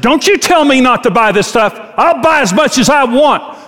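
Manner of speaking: a hammed-up husband snapping back at his wife; defiant outburst.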